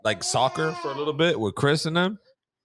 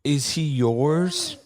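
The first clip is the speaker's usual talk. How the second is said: higher, slow sweet-lady voice; admiring lady; 5 semitones higher, slower than usual. Retold or imitated. A stranger at Chipotle admires the baby, sweet and slow. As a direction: gush over the beautiful child. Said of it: "some lady was like".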